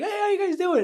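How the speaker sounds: high, loud mock-enthusiastic hello; over-cheery greeting. What nobody in his awkward family says, over-cheery. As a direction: greet everyone with exaggerated pep.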